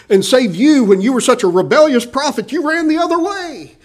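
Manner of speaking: high, loud exhorting; preacher building to a high peak; fervent preaching. Real, not acted. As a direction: drive the point home with rising fire. Preaching at Jonah.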